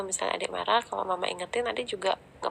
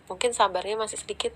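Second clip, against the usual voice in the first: ordinary talk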